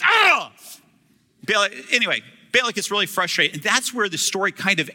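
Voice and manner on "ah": high, loud hammed-up yelp; mock shout. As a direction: yelp as if the word hurt. Balaam's cry after blessing.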